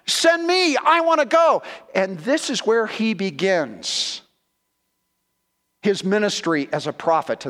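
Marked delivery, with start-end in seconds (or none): 0.1-1.6 high, eager cry of readiness; eager volunteering. Acted. Isaiah answers the Lord, eagerly raised. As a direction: step forward and offer yourself gladly. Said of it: "and he says".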